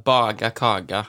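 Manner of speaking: "baga, kaga" with soft g for k; southern Norwegian soft g in "baga, kaga"; soft consonants. Shown as a demonstration. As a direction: say "baga, kaga" with voiced g in the middle. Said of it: "vi skulle hatt bløte konsonanter".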